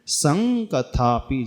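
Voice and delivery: संकथापि slow, सं held on ng; careful model of संकथापि with nasal ng; correct anusvara. Shown as a demonstration. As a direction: let the ng of संकथापि resonate before क. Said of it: "सन नहीं पढ़ेंगे".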